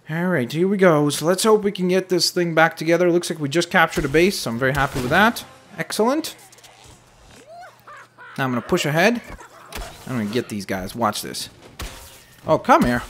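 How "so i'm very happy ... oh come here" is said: ordinary delivery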